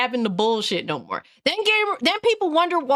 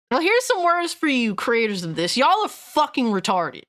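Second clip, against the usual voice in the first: normal talking voice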